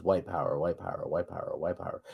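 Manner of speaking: near whisper. Shown as a demonstration. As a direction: murmur it like a hidden message. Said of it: "Everything in between is damn near a whisper".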